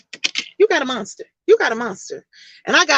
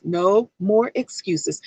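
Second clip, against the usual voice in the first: "No more excuses" slow and lower; slow emphatic; 3 semitones lower, 3 dB quieter, much slower than usual. Shown as a demonstration. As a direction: drag out "No more excuses" with weight.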